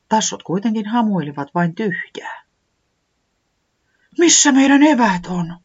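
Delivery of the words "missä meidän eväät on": high, loud, alarmed cry; horrified. Acted. Säämies finding the empty backpack, horrified and high. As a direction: gasp it out in alarm. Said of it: "Se sanoi kauhistuneena".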